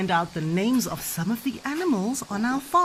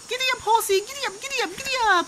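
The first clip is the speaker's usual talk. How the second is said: very high sing-song urging; playful riding call; 13 semitones higher, much faster than usual. Pretend. Mum playing giddy up with the horsey, excited. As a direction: urge on a pretend horse in a high voice.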